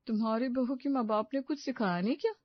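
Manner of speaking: ordinary delivery, nothing marked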